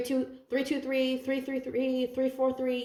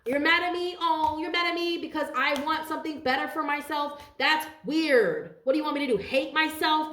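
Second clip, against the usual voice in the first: indignant comeback; 7 semitones higher than usual. Pretend. Talking back to jealous haters, high indignant disbelief. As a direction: throw it back at them, offended.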